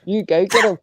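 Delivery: normal talking voice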